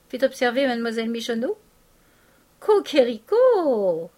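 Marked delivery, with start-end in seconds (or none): 2.6-4.1 a loud, slow mock cock-a-doodle; rooster crow. A narrator's reading. Painter crowing at Eugène's new suit, loud mock rooster. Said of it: "cria le peintre".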